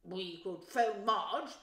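drawn-out, weighty suggestion; Uncle Vernon. A narrator's reading. Husband offering his wife a solution, slow and loud. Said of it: "Uncle Vernon suggested".

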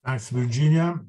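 ordinary delivery

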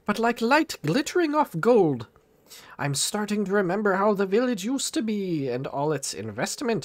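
high-pitched, put-on cartoon old miser; reminiscing Scrooge. Scrooge remembering the village's investment opportunities, high hammed-up reminiscing. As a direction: recall past riches in a high character voice.

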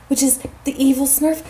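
normal talking voice

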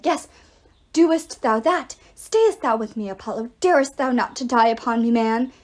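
loud archaic urging; talking arrow. A narrator's reading. Arrow pleading with dying Apollo, loud declamation. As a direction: implore like a grand stage actor.

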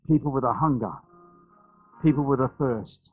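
soft, low spoken prayer over the people; hushed prayer. Acted. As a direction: pray quietly and intimately for them. Leads congregation in prayer.

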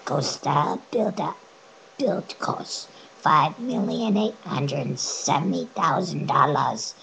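reading out in a high voice; high-pitched read-out; voice stuck high. Real, not acted. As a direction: keep the voice jammed up high. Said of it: "I can't get it back".

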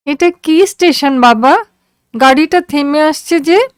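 put-on high, questioning girl's voice; a feverish girl wondering aloud; curious daughter. A narrator's reading. Daughter asking father about the station, high and curious.

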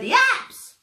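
high, loud panicked yell; zebra yelling. Acted. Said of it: "The zebra yelled".

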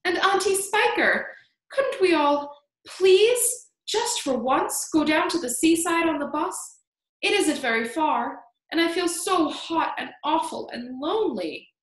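put-on high-pitched pleading little boy; child's imploring outburst; crying out. A narrator's reading. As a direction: beg the grown-ups in a high, loud voice. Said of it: "James cried out".

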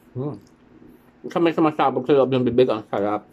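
chewing while talking; speaking around food; mouth partly full. Real, not acted. Seen 0: Bites cookie at -1.0; jaw and lips keep chewing while speaking.

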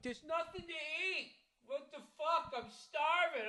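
high, soft, drawn-out mock wail; mock whiny complaint. Husband imitating his own hungry kitchen griping, high mock whine. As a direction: whine it hammed-up, way up high, as if from another room.

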